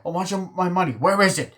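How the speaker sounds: high-pitched mock badgering; irritating nagging. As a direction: keep pestering, imitating the film character. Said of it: "the guy's just being fucking irritating".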